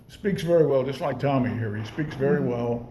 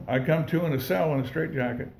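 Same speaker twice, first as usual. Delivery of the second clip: normal talking voice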